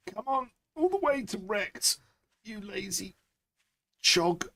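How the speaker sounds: high squeaky scolding; hammed-up high-pitched telling-off; mock scolding voice. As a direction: nag the lazy thing in a silly voice. Mock chiding in-game character.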